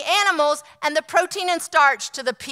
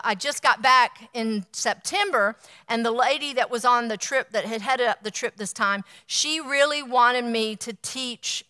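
usual speaking voice

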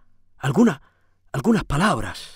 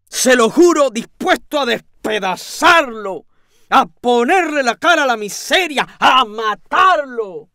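slow, much louder, seething with fury; blind rage; 7 semitones higher, 6 dB louder, slower than usual. A narrator's reading. Lodger recalling the moment he saw red, furious. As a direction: let the anger boil over each word. Narrator bursting in enraged.

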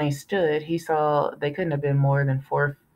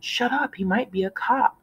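put-on 1 hushing kid; 8 semitones higher than usual. A narrator's reading. Kid hushing his brother.

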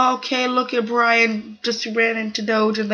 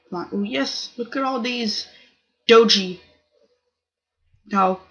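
ordinary delivery

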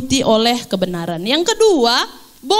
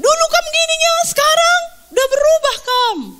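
very high-pitched mock marital nagging; accusing complaint; 13 semitones higher, 3 dB louder than usual. Preacher mimics spouses comparing then and now, accusingly. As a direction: throw the accusation in a high, put-on voice.

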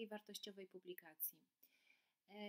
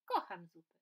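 eager deer; 3 semitones lower, 12 dB louder than usual. Acted. Deer loves soup.

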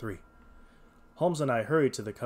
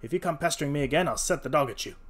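quick, loud threat; angry shout; 4 semitones higher, 6 dB louder than usual. A narrator's reading. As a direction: warn off a pest angrily. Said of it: "Breckenridge shouted".

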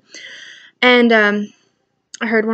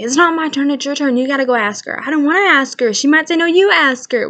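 imitating kids; 5 semitones higher, 6 dB louder than usual. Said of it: "they'll be whispering".

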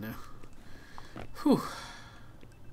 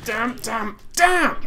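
high loud curse; frustrated shout; 6 semitones higher, 5 dB louder, much slower than usual. Real, not acted. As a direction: yell it in frustration. Frustrated cry after losing.

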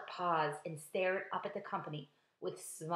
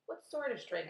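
usual speaking voice

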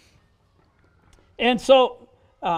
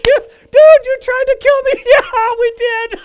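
high, loud, words squeezed through laughter; cracking up while you speak; roaring with laughter; 12 semitones higher, 12 dB louder, slower than usual. Retold or imitated. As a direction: laugh hard through every word. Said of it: "We are roaring with laughter".

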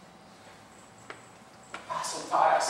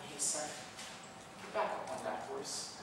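much softer gentle encouragement; soothing nudge; 13 dB quieter than usual. Acted. God nudging his son back up, softly and soothingly. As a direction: softly urge him back on. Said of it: "so soothing and calming".

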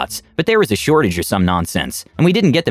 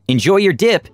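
high, sing-out retail cheeriness; fake cheery; 8 semitones higher than usual. A narrator's reading. Shop worker to humiliated customer leaving, fake cheery. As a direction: call it out through a fake smile. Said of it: "put on the biggest F U retail fake smile".

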